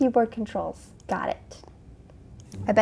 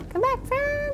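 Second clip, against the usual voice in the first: high calling voice; slower than usual. Pretend. Calling to creature.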